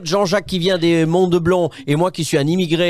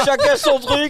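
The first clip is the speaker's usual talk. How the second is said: laughing; slower than usual. Real, not acted. Host laughs at dog love.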